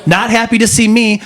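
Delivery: usual speaking voice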